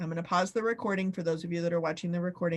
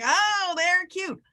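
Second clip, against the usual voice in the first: polite pet compliment; 9 semitones higher, slower than usual. Retold or imitated. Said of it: "the first thing you say is like".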